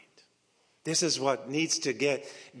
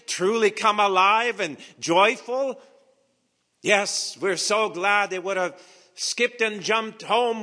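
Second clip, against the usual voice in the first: higher, louder mock-joyful sermon voice; full-force preaching; 9 semitones higher, 7 dB louder than usual. Preacher picturing the disciples' false comfort, loud and high. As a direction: paint their imagined joy loudly.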